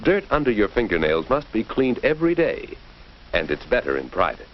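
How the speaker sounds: ordinary talk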